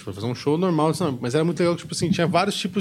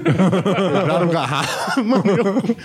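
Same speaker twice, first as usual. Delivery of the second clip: laughing; 4 semitones higher, slower than usual. Retold or imitated. Repeats quote laughing.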